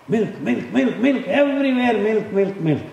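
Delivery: high-pitched repetitive hype; mock milk hype. Speaker mocking the milk craze, high chanting hype. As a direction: parody a pushy advert, voice swooping up high.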